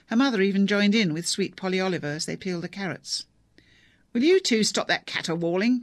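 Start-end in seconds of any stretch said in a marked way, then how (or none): 4.1-5.8 put-on loud, higher old man's yell; narrated yell. A narrator's reading. Father yelling at wife and daughter from the drawing room, loud. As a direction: bellow at them to stop singing. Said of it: "came the inevitable yell".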